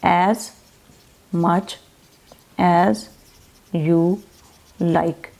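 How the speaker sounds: "As much as you like" each word split by pauses. Shown as a demonstration. Teacher explaining the phrase to students, slow word-by-word. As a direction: break the phrase apart slowly. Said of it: "क्या मतलब है?".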